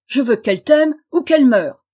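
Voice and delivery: loud, indignant roar; outraged king. Acted. Wicked king, outraged that the goose-girl refuses, loud. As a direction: thunder in disbelief.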